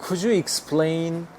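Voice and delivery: very slow, every word spaced; teacher modelling slowly; slow demo. Shown as a demonstration. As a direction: spell it out for learners. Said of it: "이런 말 붙이면 돼요".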